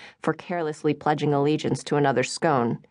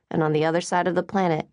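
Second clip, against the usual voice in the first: ordinary delivery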